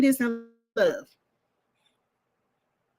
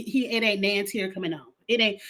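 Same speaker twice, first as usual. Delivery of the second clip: normal talking voice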